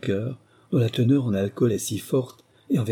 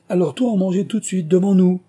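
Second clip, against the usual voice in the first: loud, high, forceful command; commanding, raised voice; ordering; 9 semitones higher, 7 dB louder than usual. A narrator's reading. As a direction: order the cook, building to a shout on the last words. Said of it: "ordonna Harry Whale".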